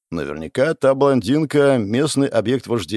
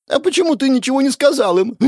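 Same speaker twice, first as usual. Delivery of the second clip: high, squeaky whining complaint; a very high, petulant girl's voice close to tears; whiny girl; 4 dB louder than usual. A narrator's reading. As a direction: pout and whine like a sulking child. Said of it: "шмыгнула Релиса".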